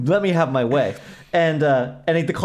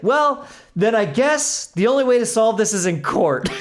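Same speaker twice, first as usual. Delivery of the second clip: higher, slow, pointed threat; mock legal threat; 7 semitones higher than usual. Customer threatening the finance rep, slow and pointed. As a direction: deliver the threat deliberately, savoring it. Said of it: "And I was like".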